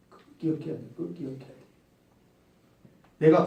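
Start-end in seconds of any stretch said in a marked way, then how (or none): 0.1-1.6 hushed, fading repeat; almost under the breath, twice; soft reminder. Real, not acted. Preacher reminding congregation, hushed and fading.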